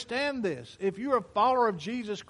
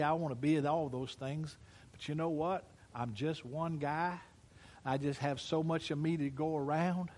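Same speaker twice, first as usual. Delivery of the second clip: ordinary talk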